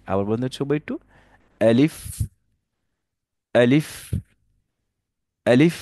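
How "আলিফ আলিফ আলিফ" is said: আলিফ quick and short, lips apart, air flowing out on ফ; correct short alif. Shown as a demonstration. Teacher demonstrating the correct আলিফ, quick and crisp. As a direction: clip আলিফ short, no long pull, breath on ফ. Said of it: "খুব তাড়াতাড়ি করে বলতে হবে".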